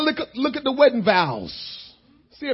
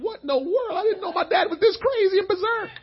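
bewildered son; 11 semitones higher than usual. Retold or imitated. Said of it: "he's wondering".

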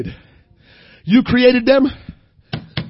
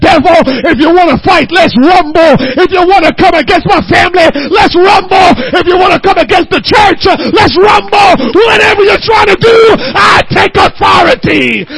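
higher, louder battle cry at the devil; defiant challenge; 7 semitones higher, 10 dB louder than usual. Retold or imitated. Preacher retelling how he challenged the devil, shouting. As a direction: dare the devil, shouting each rumble. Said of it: "I said".

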